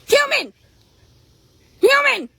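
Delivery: high, loud, insistent horse calls; horse hollering for attention. Acted. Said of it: "He's like".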